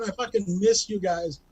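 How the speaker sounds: usual speaking voice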